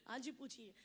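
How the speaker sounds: low, soft baritone star voice; Amitabh Bachchan impression. Amitabh greeting the comedian's mother, in a low soft baritone. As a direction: sink into a deep, hushed Bachchan baritone. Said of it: "बार बार थोड़ी मिलना बच्चन साहब से".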